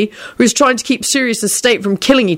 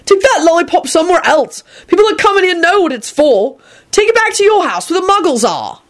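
higher, lightly voiced scolding shopkeeper; annoyed shopkeeper; 11 semitones higher than usual. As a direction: tell the pest off, mildly.

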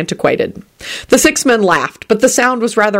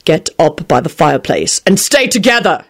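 firm command, barely raised; shouted order. A narrator's reading. Quentin to the family by the fireplace, shouted order. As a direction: give a mild shouted order. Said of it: "he shouted".

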